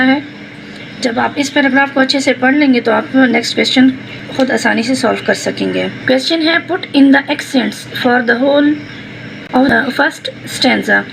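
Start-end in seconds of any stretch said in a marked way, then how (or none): none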